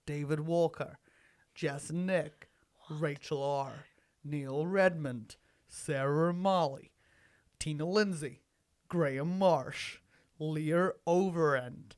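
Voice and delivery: low, measured American podcast narrator; American murder-mystery narration. Pretend. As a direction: intone each name like a sleep-time podcast. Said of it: "sounded like the narration for".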